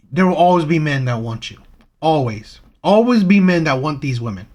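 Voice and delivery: normal talking voice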